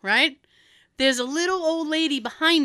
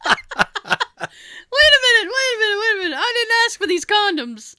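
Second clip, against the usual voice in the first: mock outrage; 9 semitones higher than usual. Caught shopper protests the planted condoms, laughing. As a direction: play the caught customer, laughing.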